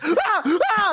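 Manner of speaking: very high mock shrieks; startled screams. Mailman barked at on the porch, startled high screams. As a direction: shriek as if scared backwards.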